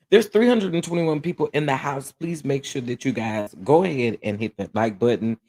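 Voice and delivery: usual speaking voice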